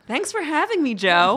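high, polished guest greeting; bright-pitched, professional interview hello; radio-interview formal. Real, not acted. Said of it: "why is this like a radio interview".

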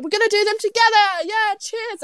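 rally everyone for a round of shots; imitating excited hostel staff; rowdy cheering. Voicing hostel staff handing out shots.

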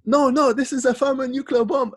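very high, loud mock villain accent; Bane. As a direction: exaggerate a strange, high-pitched villain voice. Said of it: "What kind of accent is that?".

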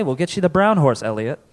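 ordinary talk, nothing marked

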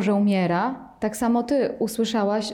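normal talking voice

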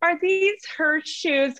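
high, slow, prim mom voice; other mom's voice. Retold or imitated. Mom imitating another parent at the playground, high and prim. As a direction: ask like a put-together playground mom. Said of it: "It's like".

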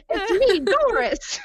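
high, laughing character line; Doris. Acted. Player suggests Doris's reply, high playful voice. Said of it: "I want you to be like".

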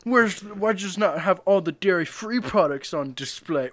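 high, loud, slow pretend outrage; loud dramatic whine; mock entitled customer. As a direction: ham up a diva customer at an ice cream shop. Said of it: "and being like".

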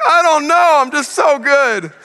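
high, slow smug teasing; sing-song taunting. Retold or imitated. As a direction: brag playfully to a child. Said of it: "I'm like".

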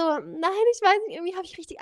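high, rushed anxious reply; mock distress. Retelling her anxious answer to her boyfriend, high and fast.

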